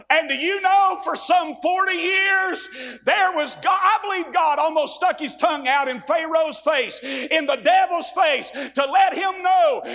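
high, loud, slow preaching climax; fiery preaching. Real, not acted. Preacher building to a climax before the congregation, loud. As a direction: drive the point home at full force.

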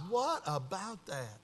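very soft, slow musing; murmured listening. Retold or imitated. Pastor hears out a troubled man, soft slow musing. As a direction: let it out slowly under the breath.

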